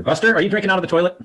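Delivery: high, loud scolding question; calling out to dog. Real, not acted. Teacher interrupts recording to catch Buster at the toilet, calling out. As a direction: raise your voice sharply at the dog.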